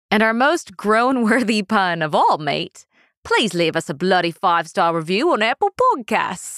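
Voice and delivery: heavy, hammed-up British accent; cartoonish British accent. Host hamming up the pun for her co-host, heavy cartoon British accent. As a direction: overdo the British, like a cartoon character. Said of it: "a British accent".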